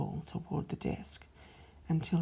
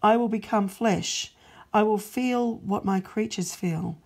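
louder, firm resolve, each I will stressed; God's loud proclamation; 3 semitones higher, 7 dB louder than usual. Acted. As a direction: announce the plan like a decree. God declares his plan.

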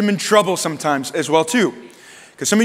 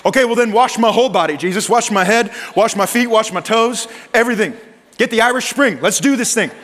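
over-eager; 5 semitones higher than usual. Retold or imitated. Peter's over-eager reply, acted.

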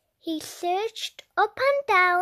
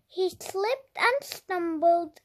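ordinary delivery

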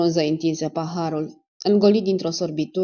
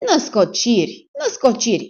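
louder, repeated indignant cry; outburst; 5 semitones higher, 4 dB louder than usual. A narrator's reading. Dentist exploding at the major's accusations. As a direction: fling the denial back twice. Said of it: "izbucni Romanescu".

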